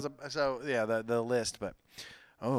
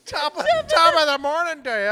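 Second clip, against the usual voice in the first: high, loud mock Irish accent; hammed-up, badly faked Irish greeting; 11 semitones higher, 7 dB louder than usual. Host trying a Gerard Butler impression, bad Irish accent. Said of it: "Can you do Irish?".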